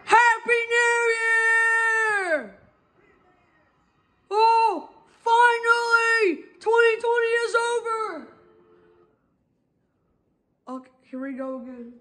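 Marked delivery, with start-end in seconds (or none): 0.1-2.6 high, slow crying shout; new year countdown crowd. Real, not acted. Midnight New Year cheer. 4.3-8.3 high, slow, joyful yell; excited shouting. Real, not acted. Cheering the year's end.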